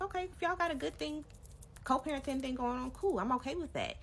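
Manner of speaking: high, lightly sing-song mock agreeable voice; airy high-pitched okay-with-it tone; mock sweet voice. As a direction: play it breezy and over-accommodating. Retelling what she told him.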